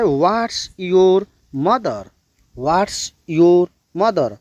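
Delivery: drawn-out model sentence, word by word; slow right version for learners; correct model, very slow. Shown as a demonstration. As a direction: say it slowly so the class can copy. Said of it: "ऐसे बोलना है".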